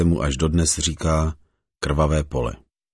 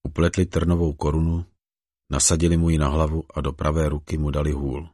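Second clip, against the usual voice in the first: ordinary delivery, nothing marked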